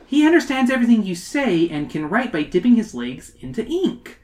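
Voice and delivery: high-pitched, eager schoolkid voice; explaining. Acted. Emily telling Scully how the fly writes, explaining. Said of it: "Emily explains".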